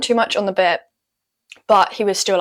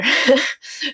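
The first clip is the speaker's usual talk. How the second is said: embarrassed laugh; 6 semitones higher than usual. Real, not acted. Laughs after forgetting the halt.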